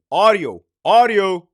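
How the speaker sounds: "audio" said twice, each one punched loud; emphatic repetition. Real, not acted. Telling officers to assume they are recorded, emphatic. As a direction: hit "audio" hard both times. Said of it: "I'm gonna say it again".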